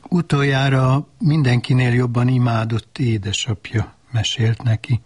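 usual speaking voice

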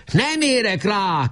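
high call over the shoulder; shouted back. A narrator's reading. Nemecsek shouts back to Weiss while running, high. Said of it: "kiáltotta vissza Nemecsek".

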